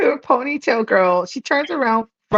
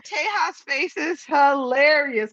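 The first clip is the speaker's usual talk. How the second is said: ordinary delivery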